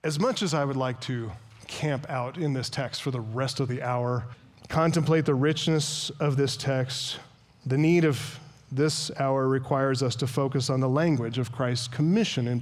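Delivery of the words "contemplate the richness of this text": usual speaking voice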